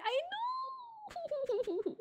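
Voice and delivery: drawn-out high wail, held back in volume. Real, not acted. Streamer is told no screaming, wails back high.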